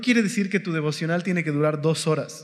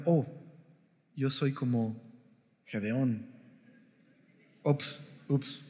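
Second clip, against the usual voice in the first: soft, sheepish aside, much quieter; hushed realization; 5 semitones lower, 8 dB quieter, slower than usual. Acted. Teacher admits he is like Gideon, hushed and sheepish. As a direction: drop the voice as if caught out.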